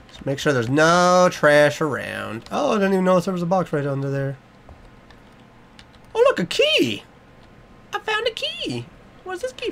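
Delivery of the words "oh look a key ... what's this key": tiny high-pitched glee; excited squeal. Pretend. Gleeful discovery of a key.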